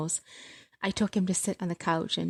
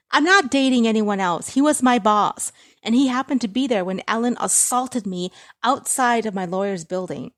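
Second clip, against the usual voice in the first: high, loud angry denial; 4 semitones higher, 8 dB louder than usual. Acted. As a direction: deny it hard to your mother, loud. Daughter denies angrily.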